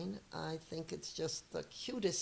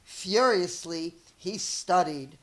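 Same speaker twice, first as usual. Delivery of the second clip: lively read-aloud storyteller voice; story narration; 3 dB louder than usual. Acted. Teacher reading a picture book to her students, lively storytelling. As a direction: read it to young kids, expressive and clear.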